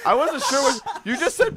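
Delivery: laughing it out. Real, not acted. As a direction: say it giggling. Laughing at misunderstanding.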